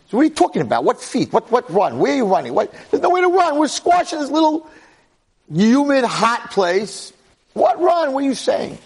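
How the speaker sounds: high, loud, agitated protest; worked-up, squeaky disbelief; incredulous arguing. Acted. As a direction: fire back baffled questions, voice climbing very high. Acting the skeptical twin arguing.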